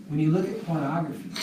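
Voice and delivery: ordinary talk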